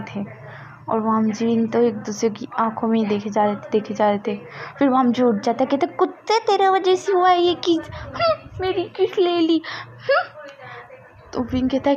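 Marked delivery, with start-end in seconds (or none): 5.9-10.3 very high, sulky complaint; whiny angry boy. A narrator's reading. Vam ji blaming Wing for the kiss, high whiny voice. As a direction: whine and sulk about the kiss.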